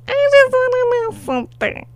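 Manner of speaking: quick high-pitched repeat; high and fast. Real, not acted. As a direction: rush it out up high. Repeated faster, still high.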